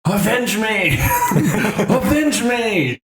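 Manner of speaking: hammy dying shout, cracking into laughter; overacted death scene. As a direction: yell it like a dying hero, laughing. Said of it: "And he's like".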